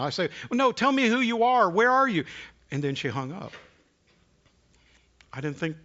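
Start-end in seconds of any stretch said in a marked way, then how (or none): 0.5-2.2 higher and louder, pleading her to stay; urgent plea. Retold or imitated. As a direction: call out before she hangs up. Calling out as she hangs up.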